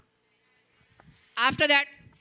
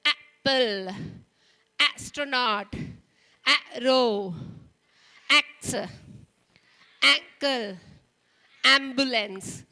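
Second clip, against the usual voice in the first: each word said louder, leaning on the first "a"; stressed word list; 2 semitones lower, 4 dB louder, slower than usual. Shown as a demonstration. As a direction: model each word for the class. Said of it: "associating the sound with the symbol".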